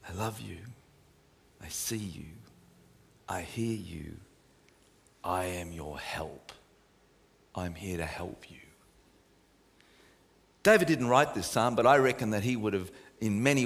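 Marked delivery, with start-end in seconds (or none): 0.1-8.6 much softer and lower, half whispered; calming hushed voice. Acted. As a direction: murmur it as a promise of help. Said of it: "he has such a calming voice".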